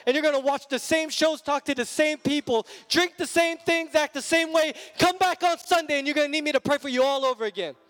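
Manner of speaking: fiery sermon voice; full-force preaching. Acted. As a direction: drive it home to the congregation.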